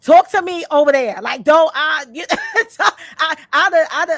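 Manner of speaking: loud, sharp mock of her old self; old [name] snapping. To the boyfriend at the door, loud mock of old [name]. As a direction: bark it out like the old hot-tempered [name].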